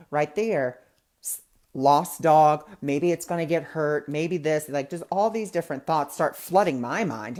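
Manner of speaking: ordinary talk, nothing marked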